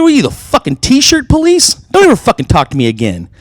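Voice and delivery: high, loud angry retort; retold angry yell. To the stranger pointing at his shirt, a loud angry retort. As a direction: snap back at the t-shirt police, loud and high.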